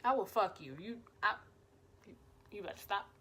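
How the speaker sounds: low, soft, stumbling warning; flustered muttering. Retold or imitated. Warning crush who pinches cheeks, flustered low mutter. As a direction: mutter a half-hearted threat.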